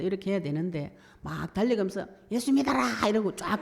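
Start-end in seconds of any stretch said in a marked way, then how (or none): 2.3-3.1 mock street-preacher shout; hasty shout. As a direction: yell it while running past. Jonah rushing through preaching.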